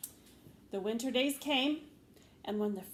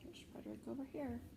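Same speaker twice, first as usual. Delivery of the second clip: ordinary delivery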